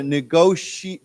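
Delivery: negotiate said slowly, syllable by syllable; slow model word. Shown as a demonstration. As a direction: spell out negotiate slowly for the class. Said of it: "why don't we say together".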